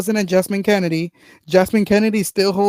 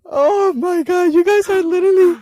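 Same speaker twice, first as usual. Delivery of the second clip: squealing excitedly; 11 semitones higher, 4 dB louder than usual. Real, not acted. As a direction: squeal in delight. High squeal reacting.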